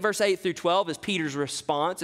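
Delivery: ordinary talk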